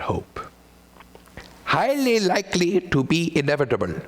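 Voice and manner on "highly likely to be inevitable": put-on Austrian accent. Repeating the doctor's line.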